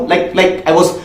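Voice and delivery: usual speaking voice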